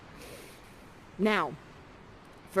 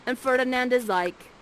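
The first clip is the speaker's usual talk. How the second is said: ordinary talk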